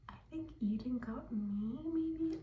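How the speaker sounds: hushed secret whisper. Real, not acted. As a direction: whisper it so nobody hears. Secret Santa reveal to camera.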